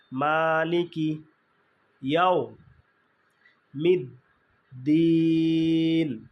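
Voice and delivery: মালিকি ইয়াও মিদ দিন spoken syllable by syllable, দিন held long; slow Quranic syllables; slow syllable demo. Shown as a demonstration. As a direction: break it into syllables and hold দিন. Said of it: "মাতে এক আলিফ ... দিনে তিন আলিফ".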